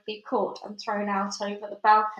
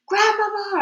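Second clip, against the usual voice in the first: high, loud desperate call through a door; loud shout; 11 semitones higher, 7 dB louder than usual. Acted. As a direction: shout for grandma as loud as possible. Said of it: "I shouted as loudly as possibly".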